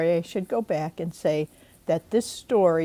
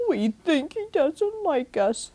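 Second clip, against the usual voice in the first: snuffling; 8 semitones higher than usual. Acted. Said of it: "snuffled Nutley".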